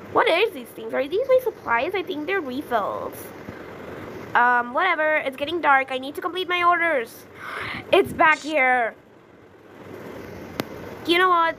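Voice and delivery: usual speaking voice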